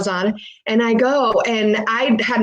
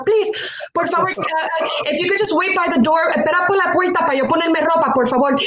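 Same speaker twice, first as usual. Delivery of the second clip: higher-pitched plea, mildly urgent; retold begging; 6 semitones higher than usual. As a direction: re-enact begging someone to wait by the door. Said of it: "I'm still begging her".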